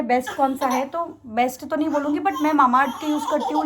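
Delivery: ordinary talk, nothing marked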